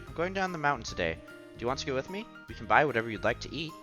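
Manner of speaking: ordinary talk, nothing marked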